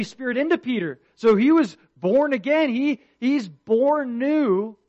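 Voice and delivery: preacher hammering home every sin to the congregation, loud and high; preaching at full force. Acted. Preacher pressing the point to his church, full-force preaching. Preacher retelling Peter's story.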